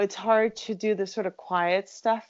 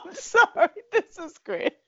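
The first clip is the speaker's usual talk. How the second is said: laughing; 13 semitones higher, 4 dB louder than usual. Real, not acted. Laughing at friend's word.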